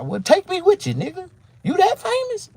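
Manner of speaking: high, loud sarcastic retort; incredulous high jab; mocking disbelief. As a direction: scoff at his fame. Mocking the rival's claim.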